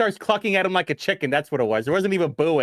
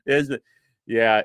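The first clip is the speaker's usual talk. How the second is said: usual speaking voice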